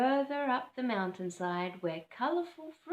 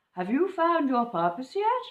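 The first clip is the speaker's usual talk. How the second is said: put-on higher, louder, curious old bird; owl voice; 6 semitones higher, 9 dB louder than usual. Boobook owl asking Cobber about his purpose, higher. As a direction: ask it like a probing owl.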